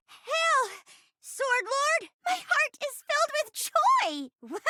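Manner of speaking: high, exultant hammy acting; overjoyed stage cry. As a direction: proclaim it high with overdone joy. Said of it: "This ending is supposed to be bittersweet".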